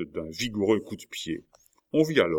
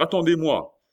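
high, louder plea to wait; calling out; 10 semitones higher, 6 dB louder than usual. A narrator's reading. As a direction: call after departing companions. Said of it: "s'écria la hurrière". (appel lancé, voix haute)

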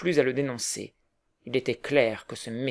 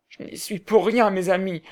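sobbing; 6 semitones higher than usual. A narrator's reading. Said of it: "dit-il en sanglotant".

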